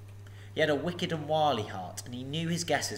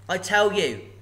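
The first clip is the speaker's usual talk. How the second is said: hobbit bravado; 7 semitones higher, 7 dB louder, slower than usual. Acted. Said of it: "in an effort to remain loyal".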